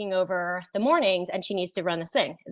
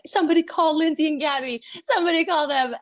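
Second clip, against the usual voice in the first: higher-pitched urgent plea; frantic; 7 semitones higher, 3 dB louder than usual. Retold or imitated. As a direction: re-enact a frantic call for help. Retelling frantic calls for help.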